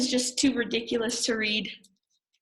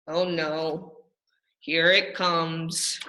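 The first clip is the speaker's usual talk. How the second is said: mock dread; 4 semitones lower, much slower than usual. Reader dreading the nonsense.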